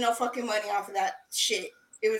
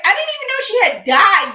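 high, loud astonishment; shocked disbelief; 8 semitones higher, 10 dB louder than usual. Retold or imitated. Telling co-host she never knew of the death, shocked. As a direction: exclaim it, pitched up and loud.

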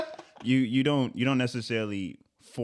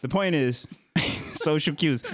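ordinary delivery

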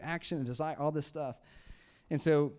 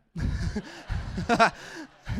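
burst of laughter; 6 semitones higher, 7 dB louder than usual. Real, not acted. Laughing at daughter's mix-up.

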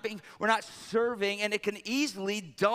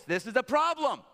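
mock scolding; 7 semitones higher, 4 dB louder than usual. Imagined prophet berating the servant.

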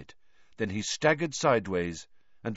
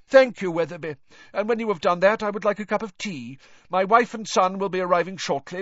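high, fluent official chatter, often loud; deranged pompous official; 6 semitones higher, 4 dB louder than usual. A narrator's reading. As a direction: prattle importantly to someone imaginary.

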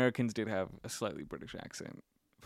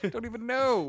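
high silly voice; 10 semitones higher than usual. Pretend.

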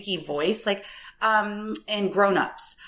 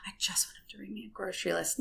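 fast, breathy whisper; whispered quote; 13 dB quieter, much faster than usual. Retold or imitated. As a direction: whisper it to him in a rush. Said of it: "told my husband so many times".

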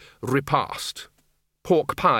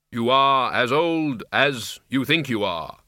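slow, hollow Death voice; grim reaper voice; slower than usual. Acted. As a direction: deliver it like solemn Death. Death speaks slowly.

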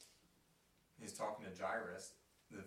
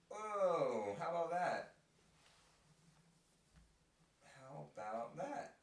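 amazed; 6 semitones higher, 5 dB louder, slower than usual. Real, not acted. Amazed at faith connection.

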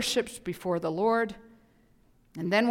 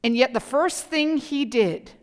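normal talking voice

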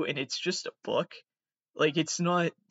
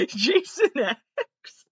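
high, slow, cracking with laughter; laughing disbelief; 10 semitones higher, 5 dB louder, much slower than usual. Real, not acted. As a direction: squeal it through laughter.

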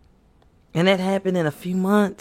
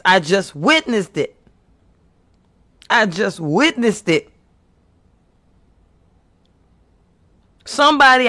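louder and higher, rising preacher's fervour; preaching at full force; 4 semitones higher, 5 dB louder than usual. Acted. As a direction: preach it out, building and pushing.

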